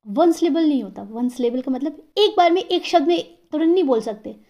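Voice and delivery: usual speaking voice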